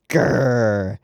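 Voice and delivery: a playful growl at the screen; mock growl. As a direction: growl like an annoyed dog, half joking. Mock growl at cruel kids.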